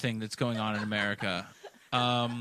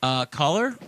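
radio host; 4 semitones higher, 6 dB louder than usual. Pretend. Hosts a mock call-in.

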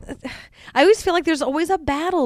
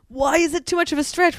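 normal talking voice

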